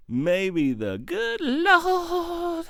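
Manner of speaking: very slow, soaring high on Lord; hammed-up, high stretched invocation; mock preaching. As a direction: draw it out like a revival preacher.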